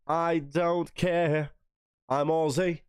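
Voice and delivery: drawled very slow, a light put-on Australian accent; mock Aussie drawl. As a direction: stretch it out like a playful Aussie mate. Quoting the song line.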